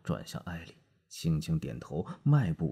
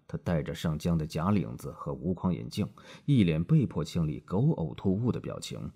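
ordinary delivery

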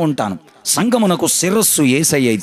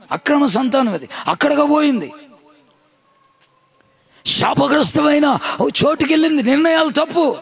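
high-pitched, urgent sermon delivery; impassioned preaching; 8 semitones higher than usual. Real, not acted. Preacher recounting Naomi's losses to the congregation, impassioned and high.

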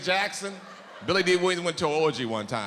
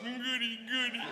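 put-on high childlike voice; 8 semitones higher, 7 dB quieter than usual.

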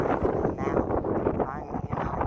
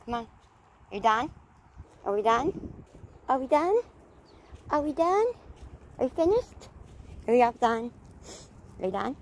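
very high, rising questions to the dog; 10 semitones higher, 3 dB louder than usual. Real, not acted. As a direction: keep coaxing the dog, climbing higher. Repeatedly asking dog if done.

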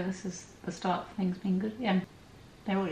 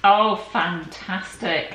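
delighted; 8 dB louder, much slower than usual. Real, not acted. Gift revealed is perfect.

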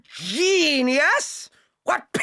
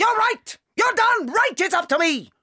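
furious shout; 8 semitones higher than usual. Acted. Headmistress bellowing at teacher.

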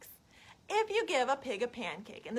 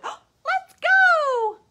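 very high, loud squeaky pig demanding syrup; pig character; 13 dB louder than usual. Acted. The pig begging the child for maple syrup, squealing high. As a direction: squeal it like an eager piglet. Pig wants to visit the farm.

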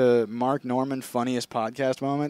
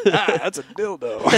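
slow, laughing Mark Norman impression; a slow, giggling impression of Mark Norman; 4 semitones higher than usual. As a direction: hold back a laugh as a Mark Norman delivery. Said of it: "channel my inner Mark Norman".

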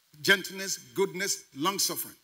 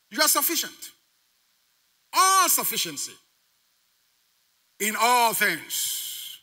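raised, emphatic pulpit delivery with long-held "All"; full-force preaching; 8 semitones higher, 6 dB louder, slower than usual. Real, not acted. Preacher declaring sufficiency to congregation, full-force preaching. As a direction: proclaim it high and strong to the room.

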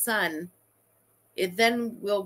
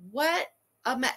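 normal talking voice

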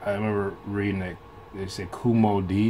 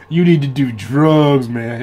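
slow, louder, higher mock dude voice pushing drugs; imitating his friend; 4 semitones higher, 9 dB louder, slower than usual. Retelling a friend's drug advice, in a mock dude voice. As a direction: drawl it out like the friend who swore by drugs. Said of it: "he was talk like that".

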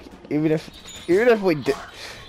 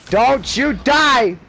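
slow, loud, higher pleading; louder, drawn-out desperate plea; 6 semitones higher, 4 dB louder, slower than usual. Real, not acted. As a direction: beg him to survive, stretching die.

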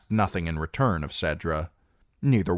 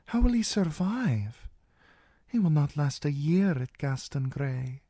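put-on high, earnest young princess; 10 semitones higher than usual. A narrator's reading. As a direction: paint a worried picture for her. Princess confides in servant.

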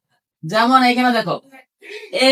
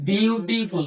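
slow, softer "beautiful"; slow dictation; 6 dB quieter, much slower than usual. Real, not acted. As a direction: draw out "beautiful" quietly while writing. Writing the word for class.

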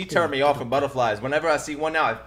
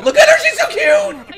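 high, loud squeal with a laugh; squealing gush; 8 dB louder than usual. Real, not acted. Gushing at the cute girl on screen, loud laughing squeal. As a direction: squeal with delight at her.

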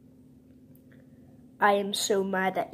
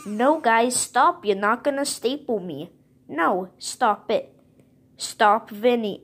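frantic protest; 4 semitones higher, 6 dB louder than usual. Pretend. Victim toy protests being stapled.

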